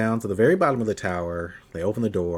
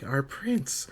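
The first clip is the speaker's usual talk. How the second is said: higher, hushed creature voice; a hint of a little demon greeting its prince; soft, raised little-creature voice; lightly voiced demonic creature; 6 semitones higher, 5 dB quieter, slower than usual.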